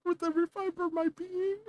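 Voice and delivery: high-pitched mock outrage with a laugh; mock-hateful player. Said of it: "be like".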